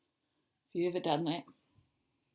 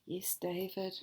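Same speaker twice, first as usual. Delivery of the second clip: soft unvoiced whisper; tender whisper; 3 semitones lower, 5 dB quieter, slower than usual. Mother reading the book, reassuring David tenderly. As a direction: say it lovingly and hushed.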